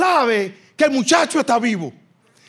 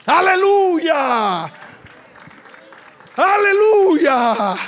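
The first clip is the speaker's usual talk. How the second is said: slow, drawn-out, louder and higher cry of praise; shouted hallelujah; 4 semitones higher, 4 dB louder, much slower than usual. Real, not acted. Preacher shouts praise to the congregation, drawn out and loud. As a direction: stretch it out and lift the church.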